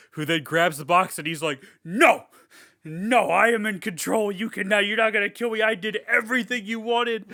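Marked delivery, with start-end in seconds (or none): 1.8-7.3 high, raised-voice protest; hammed-up indignant outburst; acted desperate defiance. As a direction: imitate a man insisting against his doom. Voices character refusing his doom.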